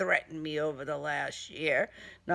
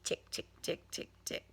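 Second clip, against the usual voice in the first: faint whispered engine ticks; whispered ticking; 12 dB quieter than usual. Acted. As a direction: tick it out softly like a dead starter. Engine failing third time.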